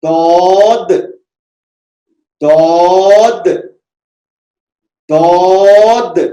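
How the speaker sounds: heavy dwad with rounded lips, loud and slow; दौद said heavy, rounded lips, tongue side on upper molars; correct Arabic dwad. Shown as a demonstration. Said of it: "जैसे".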